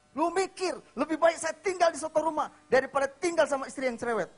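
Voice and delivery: loud, high-pitched angry retort; quarrelling husband. Retold or imitated. Husband answering his nagging wife, angry shout. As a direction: shout back in full indignation. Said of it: "Terus si suami bilang".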